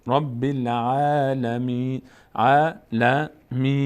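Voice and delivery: العالمین slow with a front "ae" vowel; slow teaching model of العالمین with light long a; thin, light recitation. Shown as a demonstration. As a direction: draw out العالمین lightly, unrounded. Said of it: "اما این از اون حروف نیست".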